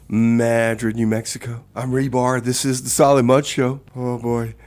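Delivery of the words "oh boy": ordinary delivery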